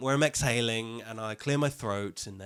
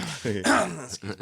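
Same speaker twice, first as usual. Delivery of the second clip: ordinary delivery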